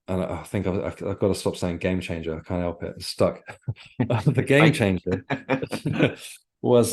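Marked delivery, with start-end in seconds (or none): 4.0-6.9 laughing through the words, drawn out; amused laughing. Real, not acted. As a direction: give in and laugh at your own catchphrase. Laughs at own repeated pet phrase.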